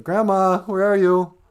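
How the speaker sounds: put-on calling little girl. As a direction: call out like a small girl looking for grandma. Red calls for grandma.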